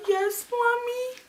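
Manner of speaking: tiny high drawn-out voice; meek, squeaky little-girl reply; timid child. Retold or imitated. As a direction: answer mom like a kid in trouble. Scared child answering angry mother.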